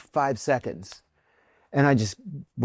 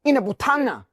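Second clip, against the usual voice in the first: higher-pitched, agitated imitation of the other man; excited retelling; 8 semitones higher than usual. Man at the accident scene telling him about his wife, excited. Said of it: "he gets all excited".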